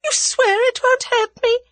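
high, loud anxious plea; wailing, desperate question; fearful pleading. A narrator's reading. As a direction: plead for reassurance in a frightened high voice. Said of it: "she temporised".

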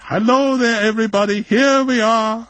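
high, loud excited greeting; excited shout. A narrator's reading. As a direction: hail the group from afar. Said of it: "shouted von Eaglefeld excitedly".

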